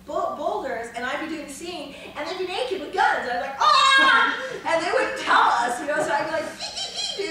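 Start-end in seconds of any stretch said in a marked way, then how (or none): 3.6-4.4 겁먹은 비명; startled scream. Retold or imitated. Startled by naked rangers. 6.6-7.3 nervous squeak. Retold or imitated. Nervous reaction to armed men.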